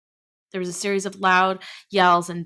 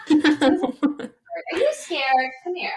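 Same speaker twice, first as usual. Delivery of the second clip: teasing laugh; 10 semitones higher, 5 dB louder, much slower than usual. Real, not acted. Teasing listener about scary story.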